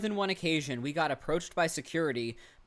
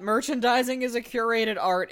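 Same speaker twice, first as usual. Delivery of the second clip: raised, louder retail pronouncement; 5 semitones higher, 5 dB louder than usual. Real, not acted. Cohost reading out own note on merchandising, very retail. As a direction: declare it like a retail professional. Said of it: "never sounded more retail".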